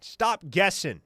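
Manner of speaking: loud, forceful repeated command, imitating a coach; Miguel Chavis chewing out. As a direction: bark it as an imitated tough coach. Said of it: "Chavis got all up in his face".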